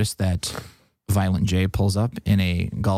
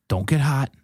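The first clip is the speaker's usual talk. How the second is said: higher-pitched imitated Michigan accent; 6 semitones higher than usual. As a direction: warn him in a put-on Michigan accent. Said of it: "a very classic Michigan accent".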